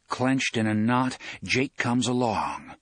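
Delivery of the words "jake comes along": ordinary delivery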